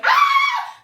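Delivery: very high, loud scream; mock scream. Babysitter screaming back at child, high loud scream. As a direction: scream right back at a shrieking kid. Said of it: "I'm literally screaming at him like".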